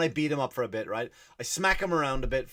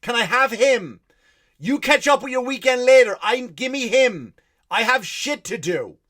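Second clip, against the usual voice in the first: loud, higher, losing patience at the glass; frantic yelling; 7 semitones higher, 9 dB louder than usual. Retold or imitated. Dad shouting through the school window at staff, frantic. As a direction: bang on the window and shout for your son. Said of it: "knocking on the window like a crazy person".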